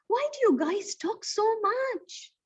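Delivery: a put-out little character complaining; upset. Acted. Question mark complains to the talkative family, upset. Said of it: "upset and says".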